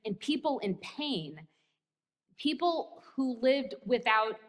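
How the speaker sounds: normal talking voice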